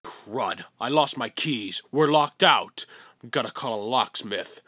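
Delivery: ordinary talk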